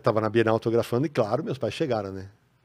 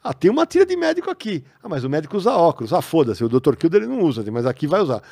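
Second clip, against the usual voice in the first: mocking careless publishers; 4 semitones higher, 8 dB louder than usual. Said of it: "O que que os caras fizeram?".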